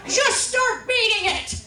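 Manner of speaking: high, loud, drawn-out holler; imitated grandma shouting insistently; mock yelled order. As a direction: bellow each word slowly as a bossy grandma.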